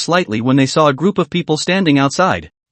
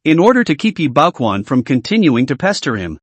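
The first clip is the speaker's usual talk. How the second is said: ordinary delivery, nothing marked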